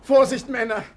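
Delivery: loud, high warning shout to the men; urgent shout of caution; calling out a warning. Acted. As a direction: yell it across the deck so they stop. Warning workers carrying heavy crate.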